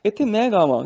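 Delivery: ordinary talk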